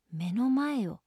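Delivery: ordinary delivery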